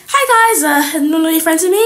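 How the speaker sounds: high, loud hopeful hello; over-eager approach. Acted. Ignored girl to her old friends, over-eager greeting. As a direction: pipe up high, pushing to be included. Outsider tries to rejoin friends.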